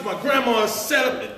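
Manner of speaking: ordinary talk, nothing marked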